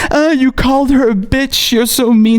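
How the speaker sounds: a touch of mimicked whining; mocking whiny critic. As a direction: sneer it like a whiny scold. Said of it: "the only thing that they can take away from this is".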